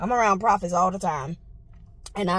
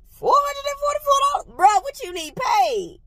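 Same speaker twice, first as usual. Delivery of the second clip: high incredulous question; can't believe the price; outraged disbelief; 3 dB louder than usual. Real, not acted. Outraged at prophet's ask.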